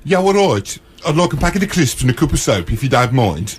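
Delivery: put-on Brummie; Brummie accent. Shown as a demonstration. Host proving they can do a Brummie accent. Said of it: "I can do a Brummie accent".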